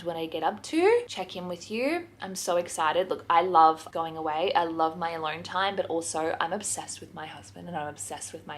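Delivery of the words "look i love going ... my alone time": ordinary talk, nothing marked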